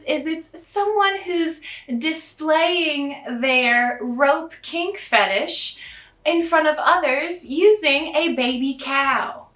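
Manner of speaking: normal talking voice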